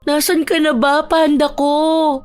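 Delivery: tearful pleading. Acted. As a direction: plead desperately for a lost pet to appear. Owner pleads for missing pet. (naiiyak at mataas na pagmamakaawa)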